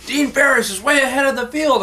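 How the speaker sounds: high excited race call; announcer voice. Retold or imitated. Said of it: "he's going".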